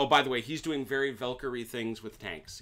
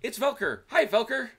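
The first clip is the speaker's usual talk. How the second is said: excited greeting; 13 dB louder than usual. Acted. Greeting the character Velker.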